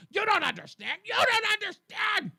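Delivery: high, loud panicked mimicry, rising to a shriek; mock hysterical. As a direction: mimic an unhinged ranter, getting shriller. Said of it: "you sound like an unhinged lunatic".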